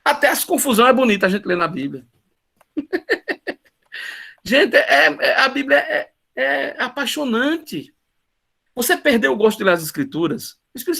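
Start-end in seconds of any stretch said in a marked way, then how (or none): 2.8-3.5 risada aguda. Real, not acted. Ri da confusão na Bíblia. 4.4-7.9 emocionado. Real, not acted. Emoção ao falar da Bíblia.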